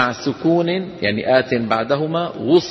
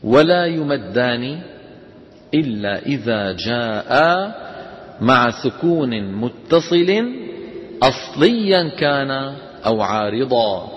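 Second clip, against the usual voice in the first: slow, deliberate reading of the rule; dictation; slower than usual. Shown as a demonstration. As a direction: read the definition slowly for note-taking. Reads the rule text slowly. (إملاء بطيء لنص القاعدة)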